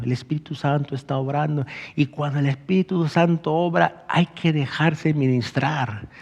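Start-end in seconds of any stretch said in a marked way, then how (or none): none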